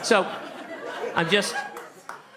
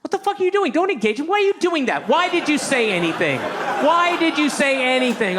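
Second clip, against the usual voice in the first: high, loud, furious inner-voice tirade; screaming at himself; 13 semitones higher, 5 dB louder than usual. Acted. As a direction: berate yourself in a mock-frantic scream. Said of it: "screaming at, at myself, going".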